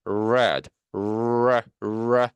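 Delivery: "red" with a long R from the back of the mouth; R resonating inside. Shown as a demonstration. Teacher showing where R rings, back-resonant R in "red". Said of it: "안쪽에서 울려요".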